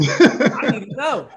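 slow, loud laughing plea; half-laughing insistence. Real, not acted. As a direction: laugh while demanding an answer.

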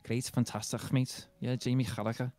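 strong Liverpool accent impression; Scouse accent. Shown as a demonstration. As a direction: do your best Scouse. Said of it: "quite a strong Scouse accent".

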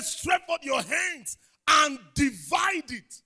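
slow, loud and high command; God's loud command. Retold or imitated. God commanding Moses to divide the sea, slow loud shout. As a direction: order Moses to stretch out his hand. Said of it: "He said".

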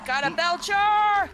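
high, loud, slow callout; calling out. Real, not acted. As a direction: shout it to the team. Calling out an enemy.

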